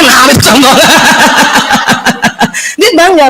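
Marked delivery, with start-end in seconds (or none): 0.8-2.7 breathy laugh. Real, not acted. Laughter after joke.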